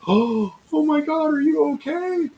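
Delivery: high-pitched gasping "oh my God" panic; mock alarm, very high and drawn out; fussing bystanders, mimicked high and slow; everybody's alarmed fussing. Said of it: "everybody goes".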